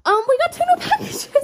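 high squealing glee with a laugh; excited squeal. Real, not acted. Vlogger announcing new packages to viewers, squealing high. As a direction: squeal it out, thrilled.

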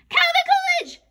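very high, loud cry of an answer; loud, high-pitched shout. A narrator's reading. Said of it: "shouted Andrea".

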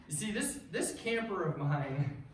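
ordinary talk, nothing marked

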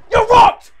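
loud, high yell; full-force mid-fight crowing; shouted taunt. Real, not acted. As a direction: yell it at a hurt opponent.

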